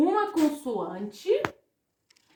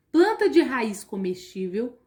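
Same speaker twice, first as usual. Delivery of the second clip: normal talking voice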